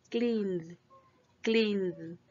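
cleaned ending in a buzzing D, no extra syllable; cleaned as one syllable with a soft voiced D; voiced final D. Shown as a demonstration. Teacher models cleaned with D not T, voiced ending. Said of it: "sino con D".

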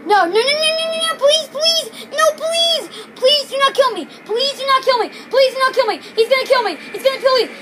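high-pitched, rapid-fire begging; panicked screaming plea. Real, not acted. Player begging the game boss not to kill them, panicked pleading. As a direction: beg for your life in a rising panic. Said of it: "I am screaming right now".

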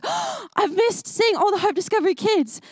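high, mock-panicked cry; panicked dream voice. Speaker retelling her dream to the kids, mock panic. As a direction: act out waking in a fright.

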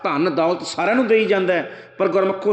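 ordinary delivery

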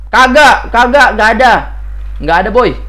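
high, loud insistent no; loud flustered protest; loud denial. Real, not acted. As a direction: deny it hard, spooked. Denies taking glass, spooked.

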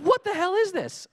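high, loud amazed disbelief; laughing disbelief at a hack; incredulous. Retold or imitated. Amazed at kludgy fix.

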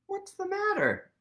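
high squeaky mouse voice; tiny high mouse friend. Acted. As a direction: pitch it up small like a mouse. Mouse voiced in read-aloud.

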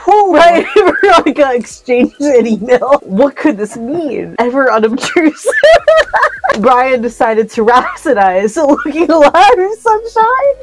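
reading through laughter. Real, not acted. Reading nonsense story to a friend, helpless laughter. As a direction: try to keep narrating while cracking up.

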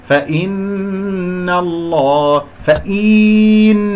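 فإن الله drawn out slowly with full ghunna; slow recitation. Shown as a demonstration. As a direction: chant فإن slowly, short kasra. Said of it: "لا أمط الكسرة".

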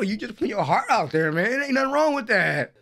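ordinary talk

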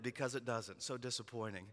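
ordinary talk

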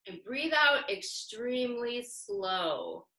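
very slow, drawn-out words easing down; slow breathing guidance. Real, not acted. Teacher guiding children's breathing, slow and drawn-out. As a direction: stretch the words to pace a long out-breath.

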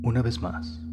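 guided meditation. Acted. Guide leading listeners through sacral chakra breathing, soft guided meditation. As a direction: guide the listener inward, softly and unhurriedly.

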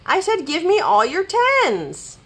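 high, insistent demand; shouting. A narrator's reading. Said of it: "shouted Rabbit".

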